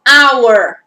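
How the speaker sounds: "hour" starting straight on the vowel, like "our"; silent H. Shown as a demonstration. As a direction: say "hour" loud, with no breath before it. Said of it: "Eu não escuto R". ("hour" com H mudo, em destaque)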